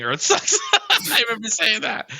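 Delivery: amused laughing. Real, not acted. Storyteller remembering the blunt complaint to the guide, laughing. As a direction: laugh through the embarrassing admission.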